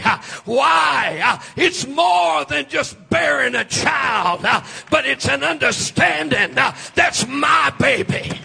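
loud, sing-song preaching with an uh after each phrase; full-force preaching. Acted. Preacher to his congregation, shouting sermon. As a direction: build the fire, shouting to the church.

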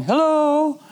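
high, ringing hello into the void; mock call into emptiness. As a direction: call out hello to someone far away. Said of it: "sometimes calling out".

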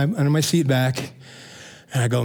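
ordinary talk

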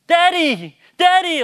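high, loud mimicked call for a parent; drawn-out falling yell, imitating a son; imitated child calling. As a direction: call out like an insistent little kid. Imitates his son calling for help.

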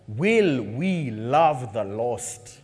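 very slow, low, drawn-out question; slow solemn question. Real, not acted. Preacher asking the church to love the lost, slowly. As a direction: ask it slowly and let it land. Sermon closing point, slow.

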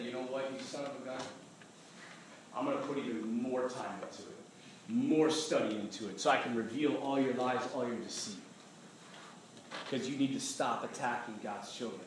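low, hushed warning; quiet defiance. Retold or imitated. Speaker telling the devil to stop attacking, quietly defiant. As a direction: tell him off softly and low. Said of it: "I told him to go to hell".

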